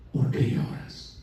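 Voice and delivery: slow, very soft whisper; hushed, drawn-out whisper; whispered question. Retold or imitated. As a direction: whisper it slowly, as to someone weeping. Repeats angels' question to weeping woman.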